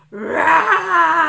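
lang gezogenes, sehr lautes Brüllen; brüll wie ein Löwe aus voller Kehle; lautes Löwengebrüll. Acted. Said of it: "Dann brüllte er sehr laut". (der Löwe brüllt, weil die Geschichte aus ist, sehr laut)

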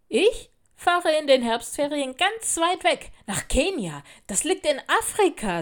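high, loud boasting; proud. A narrator's reading. Older girl boasts about holidays, proudly. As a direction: show off your trip with pride. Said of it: "sagte sie stolz".

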